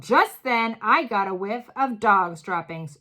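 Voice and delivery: ordinary delivery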